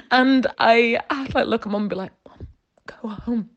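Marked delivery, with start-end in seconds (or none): 2.3-3.5 whispered, slowed plea to leave; urgent whisper. Retold or imitated. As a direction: hiss it so the boss won't hear. Urgently shooing mum away.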